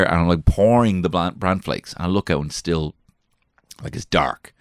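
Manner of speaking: ordinary talk, nothing marked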